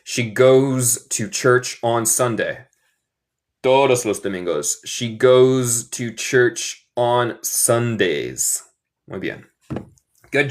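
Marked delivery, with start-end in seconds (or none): 0.0-2.7 slow, clearly separated words; slow teaching model. Shown as a demonstration. Teacher shows the one-Sunday version, slow clear English. 4.8-8.6 very slow, word by word; slow teaching model. Shown as a demonstration. Teacher shows the every-Sunday version, very slow model.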